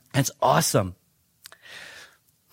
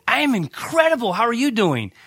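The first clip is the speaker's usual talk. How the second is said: enthusiastic greeting; 7 semitones higher than usual. Retold or imitated. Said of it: "I said".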